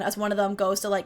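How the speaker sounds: ordinary talk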